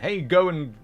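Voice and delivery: ordinary talk, nothing marked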